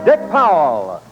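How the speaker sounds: normal talking voice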